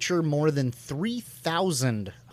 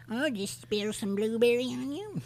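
high-pitched, softer put-on character voice; festival old lady; 8 semitones higher, 5 dB quieter than usual. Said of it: "some in attendance that are like".

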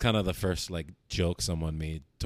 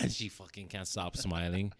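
usual speaking voice

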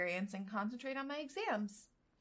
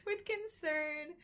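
With concern said much higher and slowly, through a laugh; amused slow correction; 8 semitones higher, slower than usual. Shown as a demonstration. Reader re-reading With concern after a misread, amused and slow. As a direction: give With concern a drawn-out amused emphasis. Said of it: "With concentration".

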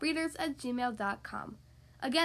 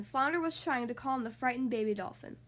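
usual speaking voice

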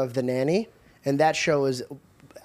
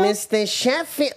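drawn-out, higher accent imitation; stretched-out mimicked catchphrase; put-on character accent; 4 semitones higher, slower than usual. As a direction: call the name slowly in a character accent. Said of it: "I'll do my accent".

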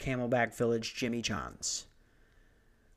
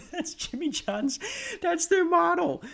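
high-pitched laughing words. Real, not acted. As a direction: crack up while talking. Laughing at his own joke.

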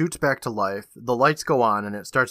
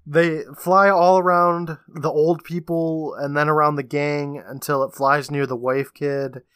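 usual speaking voice

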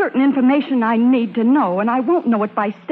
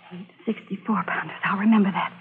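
softly whispered; 5 dB quieter than usual. Acted. Spy repeating the count in a whisper. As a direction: memorize it under your breath. Spying on enemy guns.

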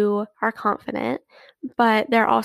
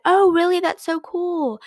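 feigned surprise; 7 semitones higher than usual. Pretend. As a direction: act amazed so they feel special.

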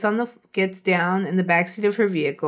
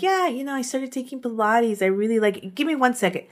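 imitating Yelka breezy; 5 semitones higher than usual. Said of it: "you're like".

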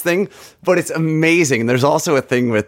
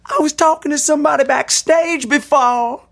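[name] rant. Retold or imitated. Mimicking a rock frontman hyping the arena crowd, high shouted. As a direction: whip the crowd up like a rock star. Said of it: "he's just like".

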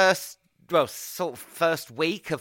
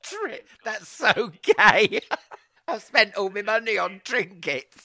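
mocking voice; 6 semitones higher than usual. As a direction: parrot him back with a mocking sneer. Host mocks guest's trinket spending.

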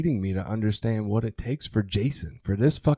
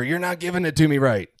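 raised, higher, louder accusing line; imitating his own yelling at his wife; mock yell; 7 semitones higher, 6 dB louder than usual. Recalling yelling at his wife, a mock yell. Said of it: "I've been yelling at her".

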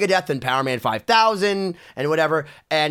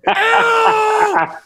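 building from firm order to high, drawn-out shout; angry yell; 11 semitones higher, 5 dB louder, much slower than usual. Retold or imitated. Rock star orders a pushy kid out, building to a full yell. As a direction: throw someone out, louder each time.

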